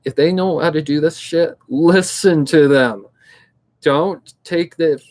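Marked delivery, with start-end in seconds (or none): 1.7-3.1 LISTEN TO THEM drawn out slowly and louder; emphatic. Real, not acted. Urging viewers to heed friends' advice, emphatic. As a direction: stretch out "listen to them" with force.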